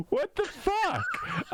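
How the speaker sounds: a high, slow, incredulous what the fuck; stunned disbelief. Retold or imitated. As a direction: let the words stretch out in shocked disbelief. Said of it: "and I was like".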